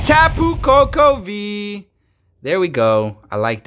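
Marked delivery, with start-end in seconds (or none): 0.0-1.9 excited announcement. Real, not acted. Announces pulled card.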